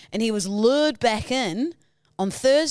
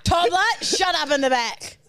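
scolding; 4 semitones higher than usual. Pretend. Scolding co-host as toddler.

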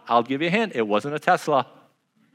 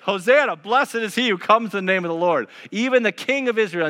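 crowd crying out; 6 semitones higher than usual. Acted. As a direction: cry it out like a cheering crowd. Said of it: "crying out".

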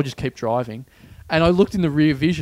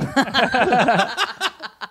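high extended laughter; long laugh; 9 semitones higher than usual. Real, not acted. Laughing at the punchline.

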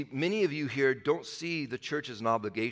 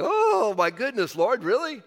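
high exclamation, half-laughing disbelief; mock exasperated surprise; 7 semitones higher, 6 dB louder, slower than usual. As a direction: sigh up at the Lord in mock disbelief. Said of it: "It's like".

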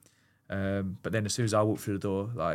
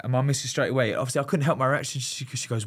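ordinary talk, nothing marked

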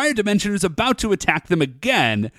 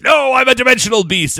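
loud, higher-pitched bluster; indignant mock protest; 7 semitones higher, 5 dB louder, faster than usual. Monster rejecting praise from the girls, mock-indignant. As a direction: protest like an offended would-be villain.

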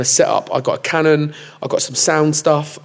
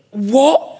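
surprised; 9 semitones higher than usual. Retold or imitated. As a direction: react in sudden disbelief. Reacting to a viral video.

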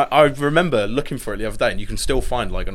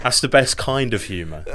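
ordinary talk, nothing marked

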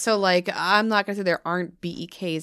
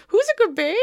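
put-on high, loud baby talk; 14 semitones higher, 7 dB louder than usual. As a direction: coo at a pet. Cooing to parrot Kiwi.

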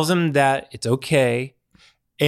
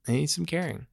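much softer, almost to himself; a hushed echo of the line before; 10 dB quieter than usual. Real, not acted. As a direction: let the repeat fall away quietly. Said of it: "repeats the phrase more softly".

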